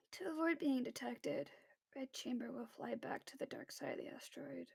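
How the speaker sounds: very soft, hushed report; subdued. Acted. Remy reporting to crewmate after crying, subdued. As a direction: say it barely above a murmur.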